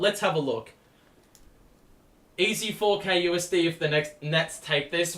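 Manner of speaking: ordinary delivery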